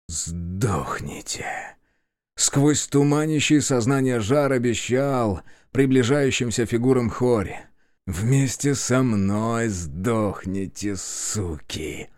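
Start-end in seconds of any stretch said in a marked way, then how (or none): 0.1-1.5 slow, hoarse whispered curse; feverish whisper. Acted. Dying gunman curses in a fever. 8.1-12.1 slow, fading hoarse curse; dying man's rasp. Acted. Dying gunman curses in a fever.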